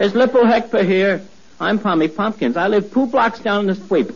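every T swapped for a P sound, "spwip" for "street"; a boy who can't say T turns it into P. Acted. Neighbour boy introduces himself, T said as P. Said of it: "He can't say the letter T".

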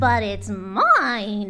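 drawn-out possessive bird squawk; mild screech, stretching the last word; mock scream. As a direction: play an indignant bird claiming her property. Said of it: "screamed the bird".

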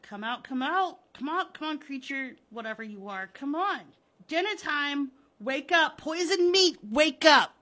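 insistent shouts to rouse a sleeper; urgent summoning. Acted. Henry yells to rouse the creature.

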